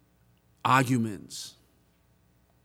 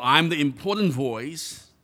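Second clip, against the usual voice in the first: IMPORTANT pushed out louder; loud, self-important emphasis on IMPORTANT; stress on IMPORTANT; 2 semitones higher, 5 dB louder than usual. Shown as a demonstration. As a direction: puff up IMPORTANT like a grand title. Mock grand title, contrasted.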